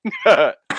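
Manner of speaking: loud high cackle of real laughter; sudden loud laugh at the screenshot; burst of laughter. Real, not acted. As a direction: crack up loudly. Laughing at a photo of hair.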